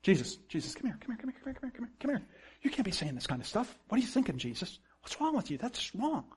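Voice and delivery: hushed rebuke. Acted. Peter takes Jesus aside to rebuke him, hushed scolding. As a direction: pull someone aside and scold them under your breath. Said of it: "took him aside and began to rebuke him".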